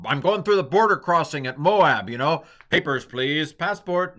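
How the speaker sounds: mock border crossing. Mock border crossing, papers please.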